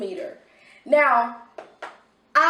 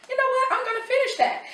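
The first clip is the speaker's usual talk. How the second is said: bright, higher officer voice; a lifted, friendly cop voice; police officer joining in; 13 semitones higher, much faster than usual. Retold or imitated. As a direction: voice the officer playing along.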